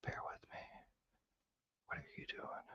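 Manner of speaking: faint voiceless whisper, focused. Real, not acted. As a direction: mouth it almost silently. Whispering while picking lock.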